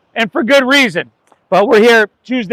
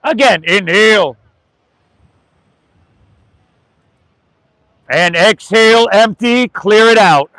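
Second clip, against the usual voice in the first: yoga teacher leading a breath; guided breathing. Acted. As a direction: guide the class through each breath.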